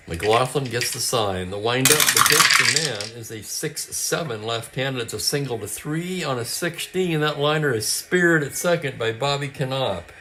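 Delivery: brisk radio ballgame call, lightly lifted; live play-by-play. Acted. Host calling a tabletop baseball game, play-by-play voice. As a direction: call it like a classic radio announcer.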